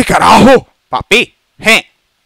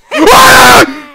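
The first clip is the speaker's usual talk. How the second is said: loud high burst of nervous laughter; 11 dB louder than usual. Real, not acted. Loud laugh after lights go out.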